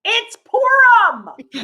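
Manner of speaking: high comic cry, very slow; drawn-out mock wail. Comedian repeats her punchline, drawn-out mock outburst. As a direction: stretch PURIM right out, high.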